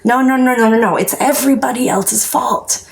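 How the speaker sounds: high, stubborn refusal voice; imitating a past self in denial; mock denial. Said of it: "I was like".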